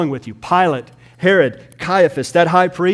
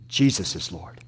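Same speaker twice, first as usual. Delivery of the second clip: much lower and softer, reverent; hushed; 9 semitones lower, 5 dB quieter than usual. Acted. Glory thieves giving glory, softly and low. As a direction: drop it down to a hush. Quiet after the climax.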